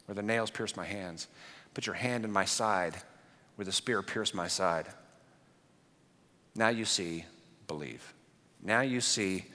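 tender Jesus. Retold or imitated. Jesus softly inviting doubting Thomas to touch his wounds. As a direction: coax him gently like a patient friend.

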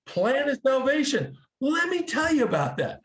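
excited high burst of enthusiasm; eager excitement. Retold or imitated. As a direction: jump in like you already know it all. Eager convert retells own reply.

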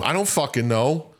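high-pitched, mildly exasperated protest; exasperated outburst. Real, not acted. Player pressed by co-hosts about game upgrades, exasperated outburst. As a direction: blurt it out in playful exasperation, pitch jumping up. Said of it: "don't yell at us".

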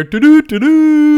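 high loud doo-doo chime; phone chime imitation. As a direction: sing it like a phone alert. Mimics phone notification sound.

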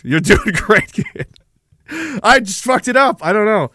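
normal talking voice

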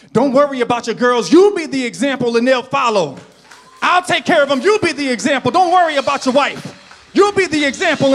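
loud, high, shouting preacher climax; preaching at full force. Acted. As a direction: build it to a roaring altar call.

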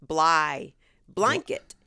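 BLANKET broken out as bly, then bly-nket; daughter's blanket. Retold or imitated. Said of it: "our daughter says".

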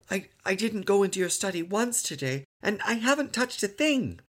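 put-on high little-boy voice; protesting child. A narrator's reading. Son protests to father, childlike. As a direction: insist on innocence like a small boy.

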